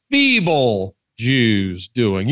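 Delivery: "feeble" drawn out very slow and low; stress on feeble. Shown as a demonstration. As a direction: lean hard on "feeble", stretching it out. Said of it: "emphasis on the word".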